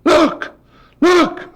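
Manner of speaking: high, eager call to his brother; excited call. Retold or imitated. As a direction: pitch jumps way up on each look. Brother excitedly calling him over.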